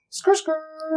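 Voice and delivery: skrt ad-lib. Pretend. Sign-off car skid noise.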